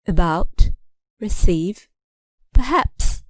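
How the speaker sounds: "About, receive, perhaps" each stressed on the last syllable; final-syllable stress. Shown as a demonstration. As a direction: separate words, hit the ending of "About, receive, perhaps". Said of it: "The final syllable is stressed".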